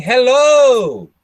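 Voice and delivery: high, loud, stretched Brazilian-style "Hello"; Brazilian intonation. Shown as a demonstration. Teacher showing what they stopped saying, loud Brazilian intonation. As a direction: exaggerate the Brazilian lilt on "Hello", drawing out the end. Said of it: "So instead of saying".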